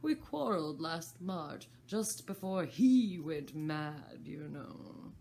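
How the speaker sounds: slow, loud Hatter grumble. Acted. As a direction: complain slowly about the March Hare. Hatter voiced in story.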